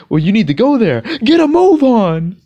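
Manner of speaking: high, loud order breaking toward tears; voice cracking. Retold or imitated. Upset driver ordering a walker off the street, voice cracking. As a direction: bark it out as your voice cracks. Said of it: "his voice is starting to crack, like he's gonna cry".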